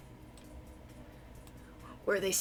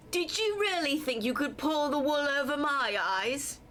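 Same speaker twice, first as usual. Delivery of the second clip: put-on villain line slipping into a country accent; accidental country accent; 5 semitones higher than usual. Scheming villain taunting the heroes in a country accent. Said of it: "doing a country accent instead of a Brooklyn accent".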